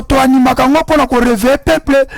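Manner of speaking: normal talking voice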